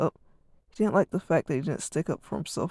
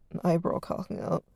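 ordinary delivery

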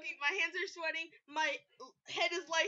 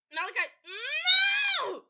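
higher, louder, slow wail; drawn-out cry; 9 semitones higher, 7 dB louder, much slower than usual. Acted. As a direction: wail the name in mock despair. Final begging.

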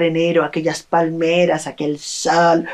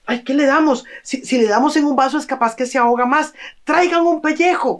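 fretting villager; 7 semitones higher, faster than usual. Acted. Villager frets over helping man.

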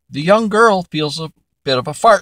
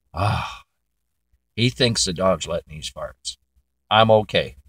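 lower, softer private thought; relieved inner thought; 8 semitones lower, 6 dB quieter than usual. Acted. The girl reassures herself at the table, lower and softer. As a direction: think it to yourself, lower and softer.

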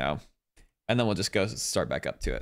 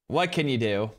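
ordinary talk, nothing marked

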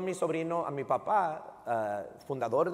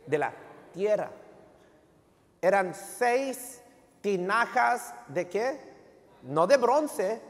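preaching; 5 semitones higher than usual. Acted. Call-and-response preaching.

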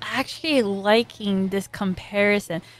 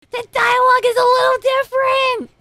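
a real squeal of surprise, higher and louder; excited outburst; 13 semitones higher, 5 dB louder, faster than usual. Real, not acted. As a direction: blurt it out with a laugh in your voice. Reacting excitedly to the dub.

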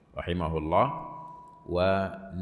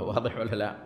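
usual speaking voice

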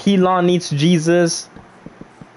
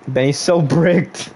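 ordinary delivery

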